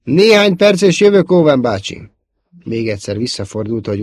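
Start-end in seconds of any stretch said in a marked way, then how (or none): 0.0-2.1 high, loud shouted answer; calling back. A narrator's reading. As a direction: call down to your uncle below. Luke calls down to his uncle. (hangosan lekiabálva)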